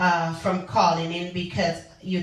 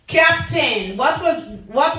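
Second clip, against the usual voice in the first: raised, higher call across the room; 6 semitones higher, 5 dB louder than usual. Real, not acted. Host calls out to a listener on air, raised call. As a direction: sing out a name to grab attention.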